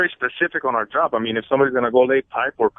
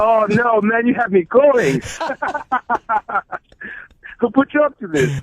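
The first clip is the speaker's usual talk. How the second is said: slow amused groan at being fooled; laughing surprise; 9 semitones higher, slower than usual. Real, not acted. Caught by a prank call.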